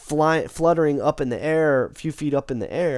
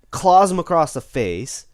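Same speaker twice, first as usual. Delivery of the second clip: usual speaking voice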